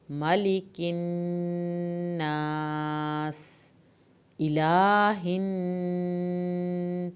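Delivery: مالک الناس chanted very slowly, the final س hissed; Quranic recitation. Shown as a demonstration. Teacher demonstrates the verse for her students, slowly chanted. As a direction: stretch الناس like a chant with a whistling س. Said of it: "آخر میں سیٹی کی آواز نکالنی ہے".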